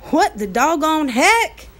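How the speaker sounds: a cowboy who can't believe his eyes; astonished cowboy. Acted. Cowboy staring at giant hands, slow and astonished. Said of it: "he said".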